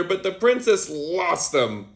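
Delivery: usual speaking voice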